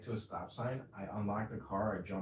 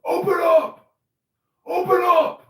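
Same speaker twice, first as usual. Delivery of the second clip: huge yelled police order, way up high; police banging and yelling; 22 dB louder, slower than usual. Pretend. Friend imagines police at the door, roaring. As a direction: bellow it like a cop at the door.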